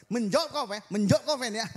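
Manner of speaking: loud, high angry scold at Fen; scolding Batak mother. Retold or imitated. Mother scolding Feni for talking back, loud angry scold. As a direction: bark it at the daughter through the door. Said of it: "Terus mamanya bilang di luar".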